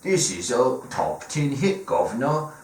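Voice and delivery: high, over-the-top Cockney banter; a hammed-up British rock band pitching a song; mock British accent. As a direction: ham up a London geezer. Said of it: "the Rolling Stones are all sitting in a room and they're like".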